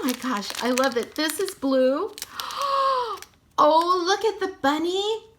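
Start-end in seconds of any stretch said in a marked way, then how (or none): 2.2-3.1 sharp high gasp of delight; real delighted gasp; excited gasp. Real, not acted. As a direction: gasp at the cute bunny. Spots the bunny figure.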